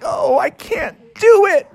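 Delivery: high whiny frog voice; high squeaky despairing frog. Acted. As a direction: whine it out, defeated. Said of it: "Froggy was like".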